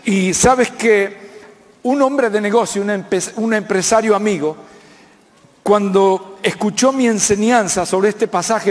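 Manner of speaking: usual speaking voice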